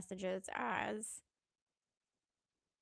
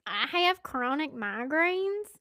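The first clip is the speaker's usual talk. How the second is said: slow, louder Southern restatement; higher, drawn-out Southern delivery; deliberate Southern re-say; 4 semitones higher, 9 dB louder, slower than usual. Shown as a demonstration. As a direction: stretch it out loudly with Southern vowels. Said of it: "that came out real Southern".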